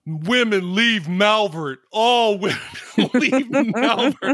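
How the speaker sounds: slow, drawn-out and laughing; imitating the character. Host quoting the odd character to friends, slow and laughing. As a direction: stretch out each word, cracking up. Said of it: "it's what he says".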